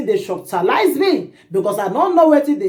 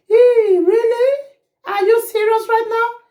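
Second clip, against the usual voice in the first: mock disbelief; 12 semitones higher than usual. Storyteller mocking the begging pastor, high-pitched incredulity. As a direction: whine a sarcastic "really?" way up high.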